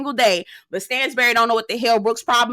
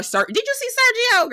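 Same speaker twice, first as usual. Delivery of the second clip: much higher, eager question; excited gossip; 12 semitones higher than usual. Real, not acted. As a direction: squeal about him to the viewers. Excited gossip about Sergio.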